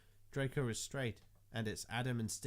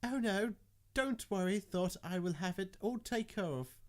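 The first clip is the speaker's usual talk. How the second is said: high-voiced reassurance; 8 semitones higher than usual. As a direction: reassure in a raised, put-on pitch. Character reassuring in a put-on voice.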